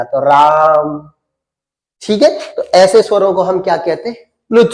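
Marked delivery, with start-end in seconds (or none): 0.2-1.1 राम with the आ held three times as long; pluta demo. Shown as a demonstration. Teacher shows students how a pluta vowel is held, stretching राम very slowly. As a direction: pull the आ of राम out to triple length, very slow. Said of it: "तीन बार के आ के बराबर खींचना पड़ेगा".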